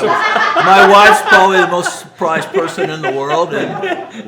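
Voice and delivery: laughing through the line. Real, not acted. Speaker to audience, laughing about his wife. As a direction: laugh while telling it.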